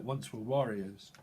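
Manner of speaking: ordinary delivery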